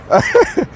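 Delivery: very high squeal of laughter. Real, not acted. Laughing at viewers.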